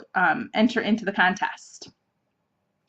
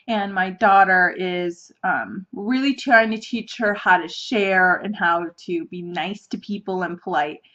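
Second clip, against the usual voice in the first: usual speaking voice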